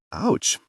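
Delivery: high, hammy fake ouch; badly faked yelp of pain. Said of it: "I fake a stumble".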